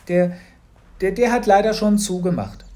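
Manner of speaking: put-on child stammering a cover story to his parents; nervous stammer. A narrator's reading. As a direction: stumble over the first word, fumbling for a lie.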